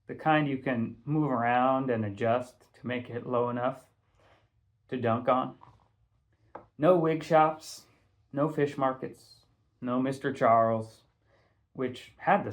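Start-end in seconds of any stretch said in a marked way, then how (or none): none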